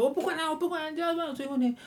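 high-pitched retold tantrum, not shouted; mock ranting customer. Agent imitates a caller who would not give details, mock ranting. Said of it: "应该是一进线就咆哮了".

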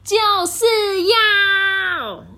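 high, loud, drawn-out "就是要"; stubborn yell; princess shouting. Acted. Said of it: "公主大叫". (公主拖长声音大叫"就是要")

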